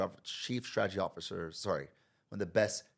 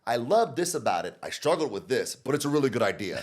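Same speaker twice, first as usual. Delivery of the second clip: louder, brisk sugar-coated praise; crap sandwich feedback; 4 semitones higher, 7 dB louder than usual. Retold or imitated. Manager serving a crap sandwich, brisk louder praise. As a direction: sweeten the critique, quickly. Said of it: "You say".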